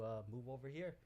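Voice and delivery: ordinary talk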